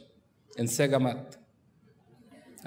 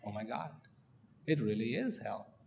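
soft, low, stunned realization; the king quietly realizing his anger; pondering realization; 4 semitones lower, 11 dB quieter than usual. Acted. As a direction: murmur it as it sinks in. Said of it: "starts to ponder".

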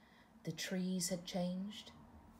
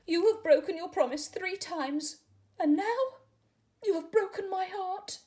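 much higher and louder, breaking into tears; heartbroken crying; 13 semitones higher, 9 dB louder than usual. Acted. Caterpillar to tadpole, heartbroken and crying, much higher and louder. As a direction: sob out that he broke your heart.

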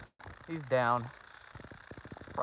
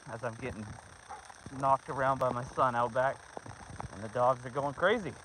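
ordinary delivery

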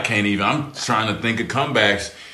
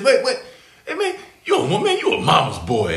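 high, teasing trash-talk voice; mocking taunt; 13 semitones higher than usual. Garnett taunting a rookie on court, high mocking jeer. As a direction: needle him like a playground bully. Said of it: "He said".

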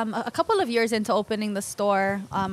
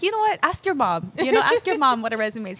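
high, louder "You know what?"; exasperated; 5 semitones higher, 4 dB louder than usual. Retold or imitated. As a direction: throw up your hands, amused. Exasperated advice to kid.

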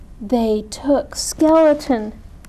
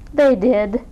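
plain reading voice

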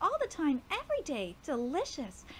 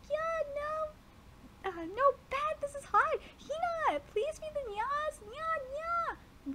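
put-on high girlish voice, playful; cheerful young girl; 13 semitones higher, slower than usual. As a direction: sing out to the cats like a little sister. Voices the sister calling cats.

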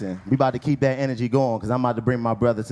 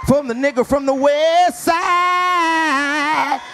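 loud, slow hype-man intro; shouted stage introduction; hype announcer; 13 semitones higher, 4 dB louder, much slower than usual. Acted. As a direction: pump the crowd up and bring him on. Hype host introducing next comic.

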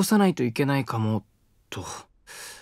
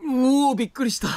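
startled; 6 semitones higher than usual. Acted. Young man startled by her outburst, surprised. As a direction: jump at her sudden outburst.

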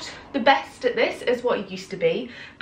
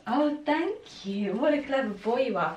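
squeaky praising a dog; 6 dB quieter than usual. Real, not acted. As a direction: coo praise at a clever puppy. Praising puppy.

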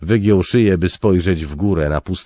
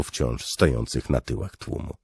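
ordinary delivery

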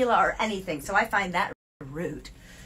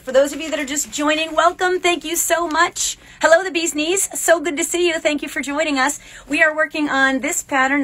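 bright welcome; 7 semitones higher, 8 dB louder than usual. Real, not acted. Welcoming live viewers.

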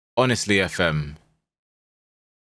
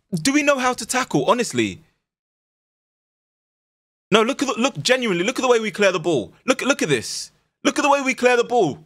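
exasperated; 13 semitones higher than usual. Real, not acted. Exasperated at defending.

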